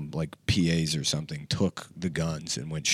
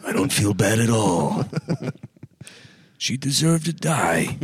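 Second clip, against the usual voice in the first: Alec Baldwin; 3 semitones higher than usual. Acted. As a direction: draw it out like a hammy film actor. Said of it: "to sound like Alec Baldwin".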